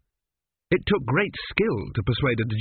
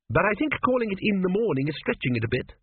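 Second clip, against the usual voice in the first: higher-pitched quibbling character voice; lightly object, pitched up; Tepic, mild objection; 9 semitones higher, faster than usual. A narrator's reading. Tepic quibbles over the riddle with the Sphinx, mildly objecting.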